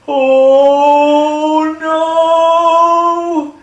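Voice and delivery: very high, loud, drawn-out wail; panicked dog. Acted. Poor dog seeing the cat attack, long high panicked wail. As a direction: stretch both words into a hammed-up cry of dread. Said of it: "That poor dog was like".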